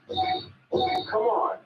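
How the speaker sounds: very high come on; chirpy high call; squeaky beckoning. Acted. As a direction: coax him along. Beckoning his younger self.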